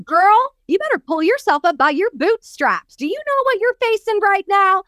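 a hint of a stern no-nonsense coach; imitating stern coach. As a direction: snap her out of it. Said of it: "He was saying".

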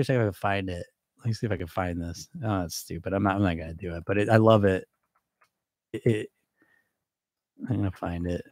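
quiet muttering under the breath; muttered aside. Real, not acted. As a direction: mumble while hunting for something off-mic. Muttering while searching for quote.

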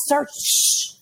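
voiceless mock shushing; urgent hushing. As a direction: hush him on a hissed breath. Officer hushing a loud man.

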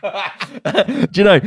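laughing. Real, not acted. Laughing while talking.

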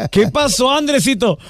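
imitating a mom answering her son; mother's voice. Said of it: "llegó con su mamá".

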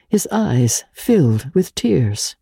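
usual speaking voice

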